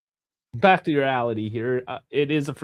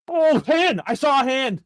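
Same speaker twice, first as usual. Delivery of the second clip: excited; 12 semitones higher, 3 dB louder than usual. Real, not acted. Spotting a handball live.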